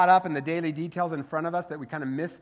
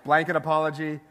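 ordinary talk

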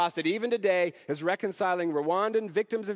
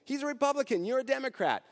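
higher-pitched retold objection from the friend; imitating the friend; 10 semitones higher than usual. Preacher voices a friend warning the woman off the meeting, high mock protest. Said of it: "And her friend said".

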